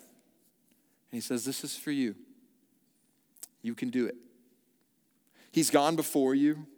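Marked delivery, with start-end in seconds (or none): 1.4-4.2 soft, tender encouragement; gentle reassurance. Retold or imitated. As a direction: reassure softly like a father. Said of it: "gentle and kind".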